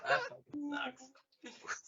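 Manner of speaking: slow, cracking up mid-phrase; can't get it out for laughing. Real, not acted. Friend laughing too hard to speak. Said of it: "Sorry, bro".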